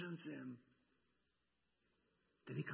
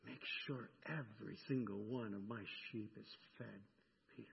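gentle commission; 6 semitones lower, 7 dB quieter than usual. Acted. Jesus's final commission.

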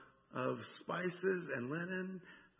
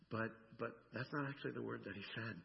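ordinary talk, nothing marked

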